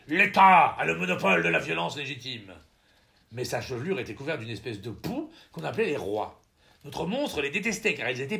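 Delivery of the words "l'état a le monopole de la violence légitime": loud roaring proclamation. Acted. Monster proclaims its monopoly of violence, roaring loudly. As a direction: bellow the decree out loud. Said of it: "Paix de Westphalie rugissait".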